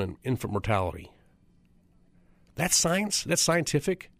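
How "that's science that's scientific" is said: incredulous. Real, not acted. Mocking the scientific claim.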